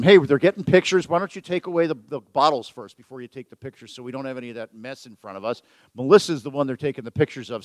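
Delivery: ordinary delivery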